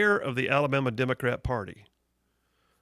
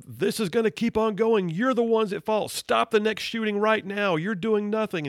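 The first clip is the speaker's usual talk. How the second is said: mock yell, pitched higher; higher, as a protester yelling at officials; retold yelling; 7 semitones higher than usual. Imitating Beto yelling at the governor, higher and pushed. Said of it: "he starts yelling".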